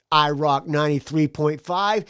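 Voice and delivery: ordinary talk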